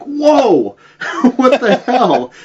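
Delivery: shocked yelp at the sight; startled shock. Retold or imitated. Naked man walks past face.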